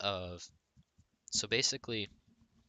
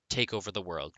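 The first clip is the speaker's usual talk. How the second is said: normal talking voice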